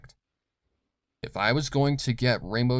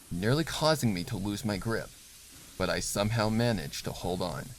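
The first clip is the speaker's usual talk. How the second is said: ordinary delivery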